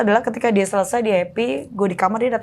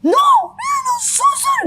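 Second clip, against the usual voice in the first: hammed-up shouted apology demand; mock shouting child; slower than usual. Shown as a demonstration. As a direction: shriek it like a bratty kid. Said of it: "Dia enggak yang kayak".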